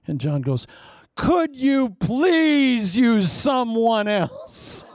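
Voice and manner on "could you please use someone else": high, drawn-out mock wail to God; hammed-up desperate plea; exasperated pleading. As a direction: beg loudly, stretching every word. Preacher begs God to spare him.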